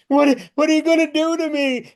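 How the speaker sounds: high, loud scared squeal; frightened cry. Retold or imitated. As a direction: shriek it like a terrified dreamer. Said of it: "and she says".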